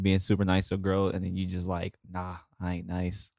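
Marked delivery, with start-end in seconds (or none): 2.1-3.3 soft, slow, cold brush-off; a hint of a sudden mean streak; mock cold dismissal. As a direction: drop it low and slow like the nice act is over. Acting a mean switch.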